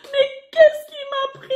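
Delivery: hammed-up sob of remorse; crying regret. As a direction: wail the regret out. Son's regret, repeated stronger.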